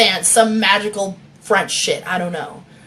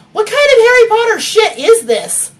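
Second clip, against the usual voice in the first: much higher, louder exclaimed question; too loud; 13 semitones higher, 7 dB louder than usual. Acted. The narrator blurts at Marie a little too loudly. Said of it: "I said, a little too loudly".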